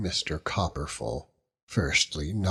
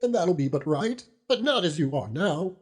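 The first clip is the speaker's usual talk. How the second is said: put-on higher, earnest old widow woman's voice; pleading old widow; 8 semitones higher than usual. Old widow comforting grieving Dannel, higher pleading voice. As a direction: beg Dannel gently, voice lifted high.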